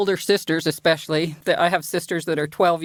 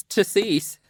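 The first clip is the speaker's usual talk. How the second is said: ordinary delivery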